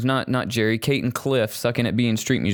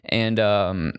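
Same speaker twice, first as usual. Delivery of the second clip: usual speaking voice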